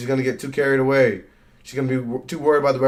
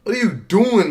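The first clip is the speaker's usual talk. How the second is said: exasperated yell; 8 semitones higher, 5 dB louder than usual. Real, not acted. Yelling at wrestler on screen.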